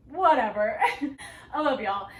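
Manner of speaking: laughing. Real, not acted. Laughing sign-off to viewers.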